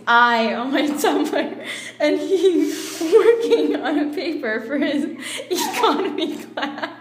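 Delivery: reading through giggles; can't keep a straight face reading it; laughing while reading. Real, not acted. Reading her fanfic aloud, breaking into laughter. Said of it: "Is economy sexy?".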